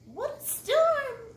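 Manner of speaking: high, slow bird voice; twittering wren. A narrator's reading. Wren remarks on the storm in a high twittering voice. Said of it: "twitters wren".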